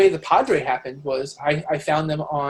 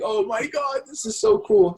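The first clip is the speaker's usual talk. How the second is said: higher, thrilled exclamation; excited; 7 semitones higher, slower than usual. Retold or imitated. Playing the finished game himself, a higher, thrilled gush. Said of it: "I'm like".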